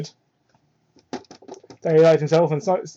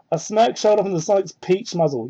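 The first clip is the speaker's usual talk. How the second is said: ordinary talk, nothing marked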